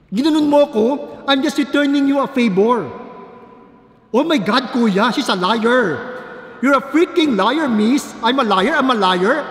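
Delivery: loud furious yelling, voice cracking high; angry shouting. Retold or imitated. Student yelling at the MRT security guard, furious. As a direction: rant at the guard, shouting her down. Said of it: "galit na galit siya".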